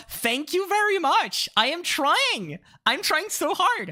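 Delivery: high, slow, hammed-up gratitude; milk the compliment; mock-flattered thanks. Real, not acted. Streamer thanking chat for the compliment, high and hammy.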